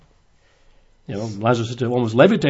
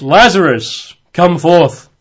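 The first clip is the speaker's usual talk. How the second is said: loud, raised-pitch commanding call; loud cry; 6 semitones higher, 8 dB louder, slower than usual. Acted. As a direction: call out as if summoning someone from a tomb. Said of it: "he cried with a loud voice".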